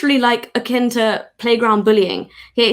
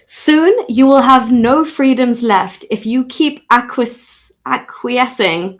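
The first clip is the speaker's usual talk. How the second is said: slow, louder posh British read; posh British accent, slow and stately; 4 dB louder, slower than usual. Shown as a demonstration. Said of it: "Okay, fine".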